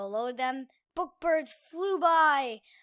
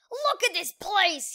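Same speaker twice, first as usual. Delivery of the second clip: angry yell; 6 semitones higher than usual. Acted. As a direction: blow your top. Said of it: "he yelled".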